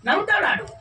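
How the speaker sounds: ordinary delivery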